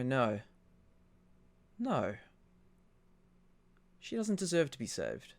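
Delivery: softly spoken refusal; quiet inner voice. Acted. Game master voices the thought steering a player, quiet.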